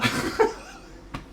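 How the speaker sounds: squealing burst of laughter; helpless high laughter. Real, not acted. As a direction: break into a high cackle. Laughing at the story.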